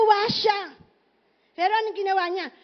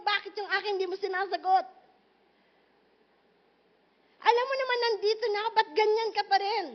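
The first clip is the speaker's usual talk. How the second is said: higher, pleading complaint to God; whiny complaint to God; 5 semitones higher than usual. Retold or imitated. Preacher mimicking believers grumbling at God, a higher whiny plea. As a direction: act hurt and ignored, nagging God for an answer.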